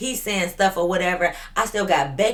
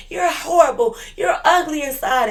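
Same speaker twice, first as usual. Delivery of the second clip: mock whiny insult; 9 semitones higher, 4 dB louder than usual. Veronica voiced insulting her.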